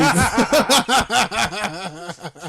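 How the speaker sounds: loud burst of laughter; hearty laugh. Real, not acted. Laughs at eating joke.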